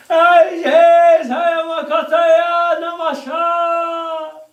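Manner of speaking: hammed-up guru voice; mock mystic proclamation.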